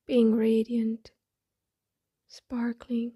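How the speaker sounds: soft, breathy meditation voice with long pauses; hushed, unhurried affirmations spoken as if eyes closed; guided meditation. Acted. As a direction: settle inward, softly, phrase by phrase.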